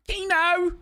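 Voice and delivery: very high held cry; mock exclamation. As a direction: belt it out high and drawn out. Exclaims in a put-on voice.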